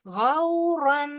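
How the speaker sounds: गौरन with the final n hidden in a nasal hum; slow nasalised recitation; nasal ghunna. Shown as a demonstration. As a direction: hum the nun of गौरन into the next word. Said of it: "गुन्ना करके पढ़ेंगे".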